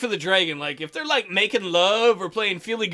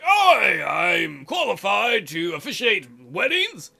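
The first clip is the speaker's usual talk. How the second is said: dragon chiming in; much slower than usual. Retold or imitated. Dragon offering to marry a couple, butting in. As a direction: butt in with a showy, drawn-out creature voice. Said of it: "like, the dragon's like".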